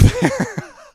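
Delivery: high squeaky laugh; real high giggle at his own joke. Real, not acted. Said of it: "so maybe I'll move".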